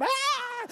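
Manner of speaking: very high held "ah" yell; imitated congregation shout. Preacher imitating everybody starting to shout, high-pitched holler. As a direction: squeal like an excited crowd. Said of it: "everybody starts shouting".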